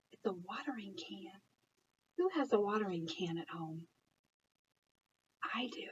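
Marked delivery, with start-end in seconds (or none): 0.1-1.3 whisper. Acted. Excited whisper over the picture. 5.5-5.9 whisper. Acted. Whispered answer to the children.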